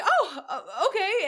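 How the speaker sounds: high, loud, stammered and giggly; flustered agreement. Retold or imitated. Starstruck fan told where to stand, flustered agreement. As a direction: re-enact being caught off guard, voice jumping up. Retelling flustered reply to celebrity.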